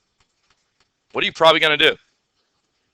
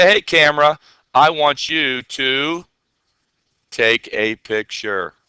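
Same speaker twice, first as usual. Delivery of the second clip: slow, deliberate order to the camera; slow command to the camera; slower than usual. Shown as a demonstration. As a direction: tell the camera slowly what to do. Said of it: "I'm gonna say".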